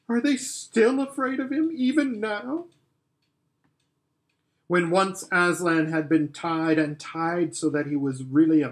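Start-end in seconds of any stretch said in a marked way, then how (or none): 0.1-2.7 much higher, tearful question. Acted. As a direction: ask it through a lump in the throat. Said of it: "sobbed Susan".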